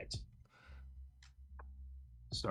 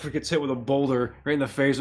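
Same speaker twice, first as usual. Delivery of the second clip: ordinary talk, nothing marked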